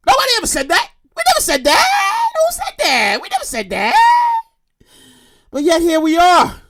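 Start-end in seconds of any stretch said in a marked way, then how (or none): 0.1-4.4 very high, squealing protest; hammed-up falsetto lament; mock wail. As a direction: whine it way up high like a comic sob. Comic wail about growing up.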